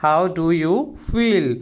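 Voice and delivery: slow, carefully spelled-out English phrase; slow and clear classroom model phrase; slow teaching demo. Shown as a demonstration. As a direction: say each word slowly for the learner. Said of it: "तो हम बोलेंगे".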